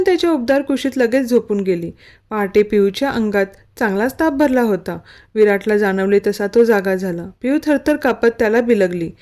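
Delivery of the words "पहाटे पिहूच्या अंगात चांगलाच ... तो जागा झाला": ordinary delivery, nothing marked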